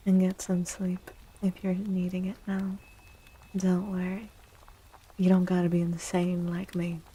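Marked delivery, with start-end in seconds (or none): none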